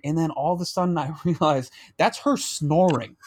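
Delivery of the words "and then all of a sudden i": ordinary talk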